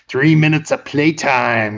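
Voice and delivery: slow, drawn-out mock menace; taunting cage fighter. Crazy fighter promises Peter a beating, taunting.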